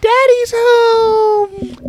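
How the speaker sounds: slow booming announcement; loud drawn-out call. Acted. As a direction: stretch it out big and loud. Announcing arrival loudly.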